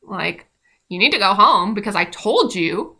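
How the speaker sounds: loud, blunt order to leave; curt order. Retold or imitated. Preceptor ordering the crying client home, loud and blunt. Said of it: "my preceptor comes back in and is like".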